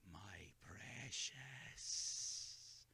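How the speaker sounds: very slow, low whispered hiss; Gollum-like whisper. A narrator's reading. Bilbo lost in greed for the ring, eerie slow whisper. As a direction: caress the words like a treasure.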